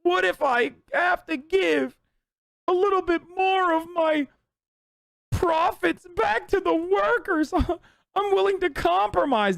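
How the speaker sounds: hammed-up blubbering imitation; mock teary boss. Streamer mocking the restaurant owner as near tears, high slow whine. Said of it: "He's like".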